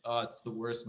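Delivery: ordinary delivery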